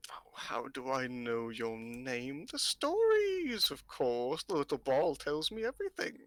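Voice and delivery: slow, drawn-out crone voice with swooping pitch; mysterious old fortune-teller. Acted. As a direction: draw it out like a knowing old seer.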